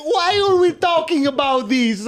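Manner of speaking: put-on high, wailing Italian accent; drawn-out, high-pitched Italian complaint; hammed-up Italian accent. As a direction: plead like an exasperated cartoon Italian. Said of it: "I had to snap out of that one".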